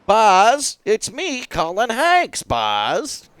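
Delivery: high excited Woody-style voice calling Buzz; Colin Hanks impression. Doing his best Colin Hanks impression for the game. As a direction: pitch it way up and plead with Buzz. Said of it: "it's my best impression".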